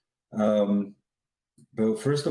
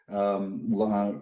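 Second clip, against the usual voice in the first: ordinary talk, nothing marked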